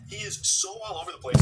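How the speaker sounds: high, loud exasperation; exasperated. Real, not acted. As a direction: throw up your hands. Exasperated at his messages.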